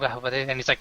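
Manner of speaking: usual speaking voice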